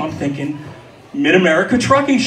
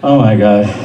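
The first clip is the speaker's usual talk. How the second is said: touched, laughing; 8 semitones lower, 6 dB louder than usual. Retold or imitated. Touched by the boy's request.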